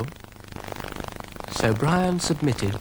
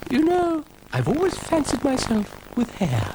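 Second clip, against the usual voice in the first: high, fluting ladylike musing; dotty lady cow. A narrator's reading. Ermintrude dreams of having hair, high fluting voice. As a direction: muse aloud in a high flute. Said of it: "Ermintrude thought".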